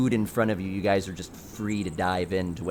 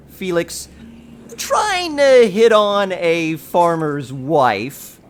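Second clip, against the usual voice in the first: teasing; 7 semitones higher, 9 dB louder, much slower than usual. A narrator's reading. Narrator teases Felix's flirting.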